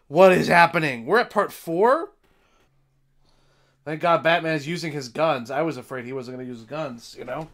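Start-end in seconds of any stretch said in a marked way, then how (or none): none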